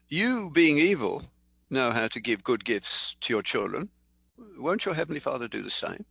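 usual speaking voice

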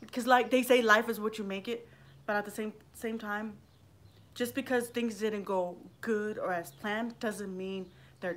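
usual speaking voice

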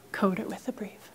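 whispered instruction, soft and secretive. Shown as a demonstration. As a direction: drop to a hush for the rule. Teacher whispers instruction in demo.

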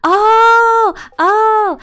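loud, high moaning "oh"; dismayed outcry. Real, not acted. Player finds they need coal, loud dismayed cry. Player cries out over missing material.

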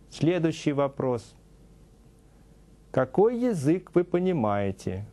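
ordinary talk, nothing marked

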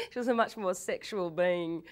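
ordinary talk, nothing marked

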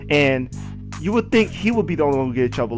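ordinary talk

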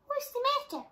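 very high, light little bee; tiny bee voice. Acted. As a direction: squeak it like a small buzzing bee. Little bee asks animals.

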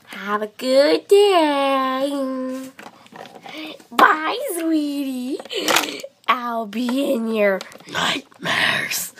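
very slow, drawn-out sinister farewell; creepy slow goodbye. Acted. Vet bids the pet a slow sinister goodbye. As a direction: savour each word like a villain.

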